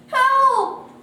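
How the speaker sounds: high, loud cry for help; pleading. A narrator's reading. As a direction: plead at full voice. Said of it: "he pleaded".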